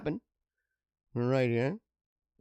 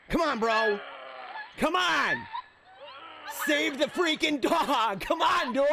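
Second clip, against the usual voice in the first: urgent cheering; 10 semitones higher, slower than usual. Real, not acted. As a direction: yell at the video like a fan. Cheering on the rescuer.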